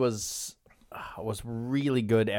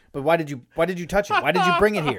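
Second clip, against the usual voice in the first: mock scolding clerk; 7 semitones higher, 6 dB louder, faster than usual. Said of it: "She's like".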